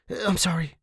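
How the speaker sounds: ordinary delivery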